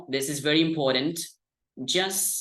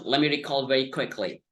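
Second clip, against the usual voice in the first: ordinary talk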